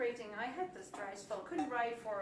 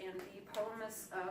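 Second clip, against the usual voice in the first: ordinary talk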